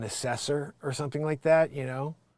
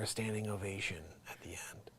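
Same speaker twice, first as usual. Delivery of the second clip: whispered aside; 5 semitones lower, 14 dB quieter than usual. Real, not acted. Hushed aside about ovation.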